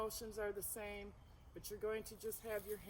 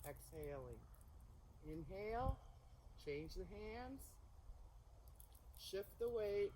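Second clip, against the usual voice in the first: serene tai chi cues; 5 dB quieter than usual. Shown as a demonstration. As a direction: call each move gently, unhurried. Leads the flowing form.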